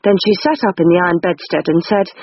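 ordinary delivery

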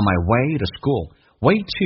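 "way to" run together with the to reduced, said slowly; linked, reduced. Shown as a demonstration. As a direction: glide "way to" together, slow demo pace. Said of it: "My像双元音近似语法单元音".

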